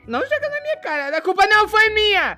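high, protesting outburst; indignant denial. Acted. Blamed for a sister's death.

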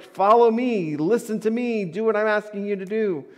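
loud, high divine command; commanding call. Retold or imitated. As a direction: call out to a wandering people. God calling to wandering Israel.

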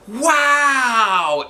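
high, loud amazed shout; a crowd of kids gasping out wow; kids' wow. A narrator's reading. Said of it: "everybody said again".

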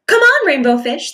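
high playful fish friends calling. Acted. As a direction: call out to a friend to play. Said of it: "they would call".

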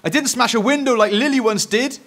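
put-on high, loud defensive boy; insisting. A narrator's reading. Eric points at his sister's past, insisting. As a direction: protest loudly to deflect blame. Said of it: "Eric insisted".

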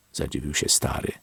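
ordinary delivery

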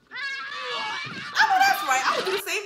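slow, high, loud holler; drawn-out shout. Real, not acted. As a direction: stretch it out and yell it. Hollering agreement at the movie.

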